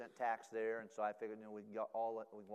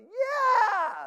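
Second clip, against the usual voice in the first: high, loud excited yell; gleeful shout of yes; kids cheering; 19 dB louder than usual. Retold or imitated.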